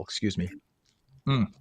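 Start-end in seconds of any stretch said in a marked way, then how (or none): 1.3-1.5 talking through a mouthful; words round a bite of food; mouth full. Real, not acted. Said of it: "talk with my mouth full".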